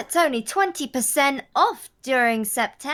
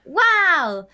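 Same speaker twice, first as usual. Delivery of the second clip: infomercial wow; 2 semitones higher, 5 dB louder than usual. Acted. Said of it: "you sound like an infomercial actor".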